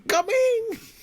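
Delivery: very high, loud yelled name; shouting. Retold or imitated. Husband re-enacting his own shout for the wife, loud. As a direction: hurl the name out in joy. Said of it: "My husband is shouting".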